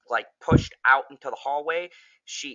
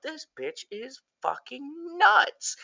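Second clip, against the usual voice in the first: incredulous; 13 semitones higher, slower than usual. Retold or imitated. Viewer's own reaction, mock quote.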